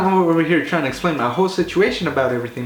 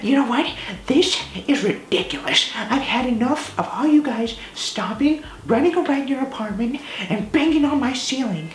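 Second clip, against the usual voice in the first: high, whiny imitation of the neighbor; mocking angry neighbor; 9 semitones higher than usual. Imitating the angry downstairs neighbor yelling about noise. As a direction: pitch it up into a shrill mock rant. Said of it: "all mad, already starting to yell like".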